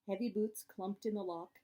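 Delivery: ordinary delivery, nothing marked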